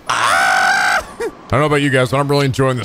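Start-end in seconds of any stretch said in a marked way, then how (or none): 0.1-1.1 long, very low "aaah"; low groan. Real, not acted. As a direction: drop into a deep held groan. Reacts to the bowling game.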